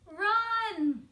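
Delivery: high, loud shout. A narrator's reading. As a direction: yell it as a warning to flee. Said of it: "shouted Fox".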